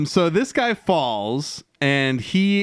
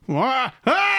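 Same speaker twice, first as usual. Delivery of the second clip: a sudden high yelled howl; joke Klingon howl; 12 semitones higher than usual. Pretend. Joining the Klingon death howl, high mock yell. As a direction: throw back your head and bellow it for laughs. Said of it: "and I'd go".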